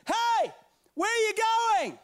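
high mock call from across the car park. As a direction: shout it from far away. Friend calling from far off.

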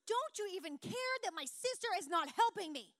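loud, high, exasperated complaint; mad Martha. Retold or imitated. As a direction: whine at Jesus in mock outrage. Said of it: "She's like".

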